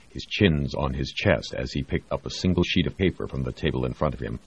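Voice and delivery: plain reading voice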